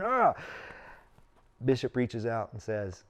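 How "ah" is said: high, loud exasperated "ah"; frustrated cry. Retold or imitated. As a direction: let out a burst of exasperation. Retelling his frustration at not being asked.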